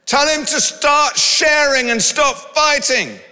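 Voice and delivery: put-on high, slow child complaint; whining kid. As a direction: whine like a squabbling kid. Kids complaining from upstairs.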